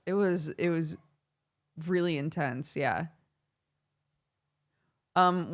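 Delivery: ordinary delivery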